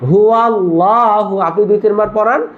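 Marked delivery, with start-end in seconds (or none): 0.1-1.4 হু আল্লাহু slow and stretched with a thick la; model pronunciation. Shown as a demonstration. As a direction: draw out হু আল্লাহু for the class to repeat. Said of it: "কি উচ্চারণ?".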